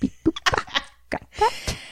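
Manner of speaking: high whispered beep noises; robot beeps. Pretend. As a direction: chirp it like a computer. Mock typing a note.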